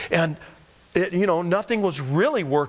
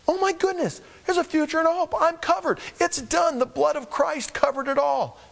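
much higher, rising praise; joyful preaching; 13 semitones higher than usual. Acted. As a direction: lift the congregation with joy. Preacher rejoicing at full force.

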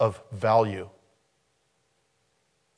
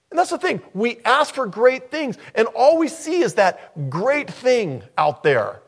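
ordinary delivery

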